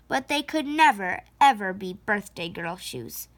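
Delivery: ordinary delivery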